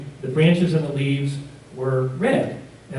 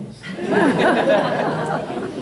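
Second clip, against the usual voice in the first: laugh; 12 semitones higher than usual. Real, not acted. Laugh after the story.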